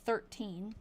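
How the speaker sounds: normal talking voice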